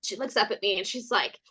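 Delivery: usual speaking voice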